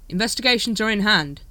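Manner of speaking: automatic official reply; Vimes. A narrator's reading. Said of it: "said Vimes automatically".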